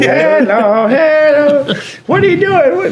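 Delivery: very high squawky parrot voice; macaw impression. Owner imitates his macaw greeting people, squawky. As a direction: chirp it out like a talking bird. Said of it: "she was like".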